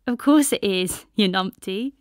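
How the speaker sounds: loud, happy teasing; cheerful teasing. A narrator's reading. Bess teasing Ishal down the phone, happily. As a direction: tease her friend gladly.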